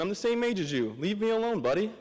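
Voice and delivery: high-pitched, playful imitation of his younger self complaining; mock indignant protest. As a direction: whine back at the sergeant in jest. Retold inner protest at being scolded.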